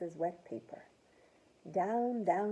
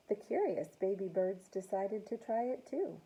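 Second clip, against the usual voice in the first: usual speaking voice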